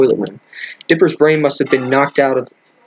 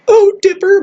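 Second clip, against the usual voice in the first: high falsetto mock weeping; hammed-up tearful girl's voice, pitched way up; tearful Mabel; 4 dB louder than usual. A narrator's reading. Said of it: "Mabel said through her tears".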